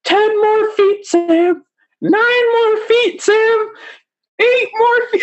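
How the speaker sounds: very high falsetto countdown screech; hammed-up mock yelling, squealed high; imitated yelling. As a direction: mimic a frantic guest yelling in your ear. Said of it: "she's there yelling in my ear".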